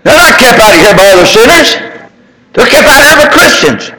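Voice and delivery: high, ringing preacher's call, building in fervor; full-force preaching. Acted. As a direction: preach it like you're lifting the whole room.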